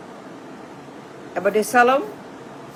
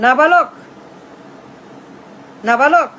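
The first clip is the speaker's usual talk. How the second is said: loud, sneering taunt; loud scornful jeer; taunting shout; 2 semitones higher, 5 dB louder than usual. Real, not acted. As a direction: hurl the insult twice, loud. Orator taunting the rival repeatedly.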